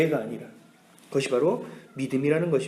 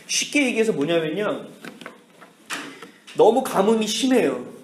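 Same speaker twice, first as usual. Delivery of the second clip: ordinary talk, nothing marked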